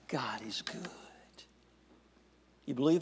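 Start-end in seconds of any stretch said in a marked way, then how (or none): 0.1-0.9 whispered response. Acted. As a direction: whisper it, hushed. Preacher leads call and response.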